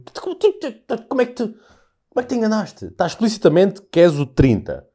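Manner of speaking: sputtering high disbelief at a seat thief; mock-outraged passenger; indignant scolding. Scolding a seat-stealer, acted.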